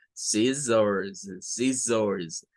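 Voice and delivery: "Scissors" low and measured, both syllables ending in z; "scissors" with a drawn-out buzzing final z; pronunciation model. Shown as a demonstration. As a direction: repeat "scissors" carefully for learners. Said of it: "repite al final el mismo sonido".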